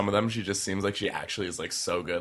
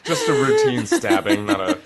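much higher and louder, laughing through it; laughing sarcasm; 10 dB louder than usual. Real, not acted. Joking about the stabbing.

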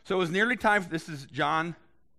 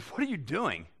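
mild indignant challenge; 4 semitones higher than usual. Retold or imitated.

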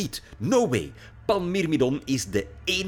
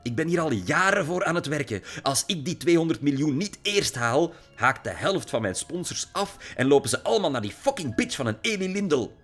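angry rant; 7 semitones higher, 4 dB louder than usual. A narrator's reading. Lily furious at her father.

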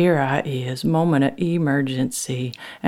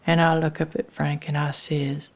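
plain reading voice

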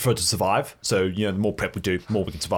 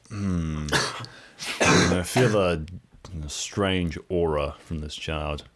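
slow low character voice; 3 semitones lower, much slower than usual. Acted. Mayor voiced in the story.

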